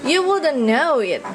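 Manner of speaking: plain reading voice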